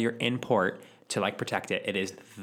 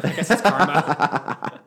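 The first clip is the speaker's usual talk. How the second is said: laughing; 7 semitones higher, 10 dB louder, much slower than usual. Real, not acted. Laughing self-mockery.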